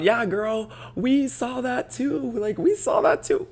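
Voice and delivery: high, drawn-out mock-girl voice; imitating commenters in a high-pitched whine. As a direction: squeal it like a gushing commenter. Mocking quote of TikTok commenters.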